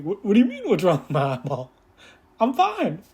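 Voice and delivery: high, loud, indignant denial; imitating a defensive guy. He insists to her he's fine, high and indignant. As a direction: play him protesting he's fine. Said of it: "He's like".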